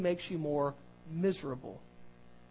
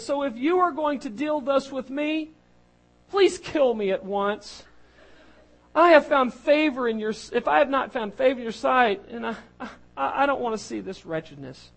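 dramatic Moses lament; 6 semitones higher, 6 dB louder than usual. Acted. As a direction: cry out to the Lord as a burdened servant. Reading Moses's plea dramatically.

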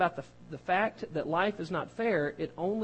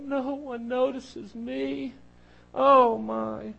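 high, slow self-pity ending in a louder sigh; mock martyr; 6 semitones higher, 3 dB louder, much slower than usual. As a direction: sigh it out like a martyr who is never noticed. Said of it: "The favorite phrase of a martyr".

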